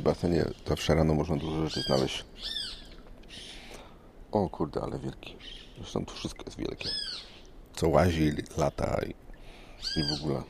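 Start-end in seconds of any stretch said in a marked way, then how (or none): none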